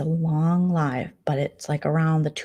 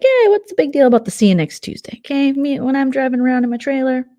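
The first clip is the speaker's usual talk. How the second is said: defensive retort; 7 semitones higher, 7 dB louder than usual. Retold or imitated. Acting out Lumberjack in retold row.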